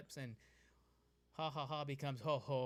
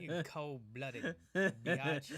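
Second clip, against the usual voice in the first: drawn-out word through laughter; slow laughing tease; laughing slow drawl; 5 dB louder, much slower than usual. Pretend. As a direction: stretch it slowly while laughing. Teasing lizard woman.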